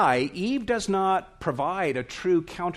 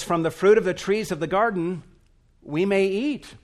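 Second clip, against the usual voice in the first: brisk, lively and louder, pitched higher; bright quote; 6 semitones higher, 4 dB louder than usual. Retold or imitated. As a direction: read Eve's words with fast eager lift. Eve quotes God's words brightly.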